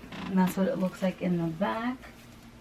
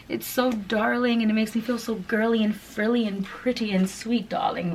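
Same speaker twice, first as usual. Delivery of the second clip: gushing delight; 4 semitones higher than usual. Pretend. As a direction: swoon over the top like a delighted girl. Gushing over a pretty top.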